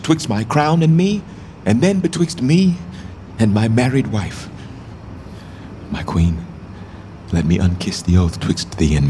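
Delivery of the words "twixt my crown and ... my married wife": anguished cry. Acted. Richard protesting the forced parting.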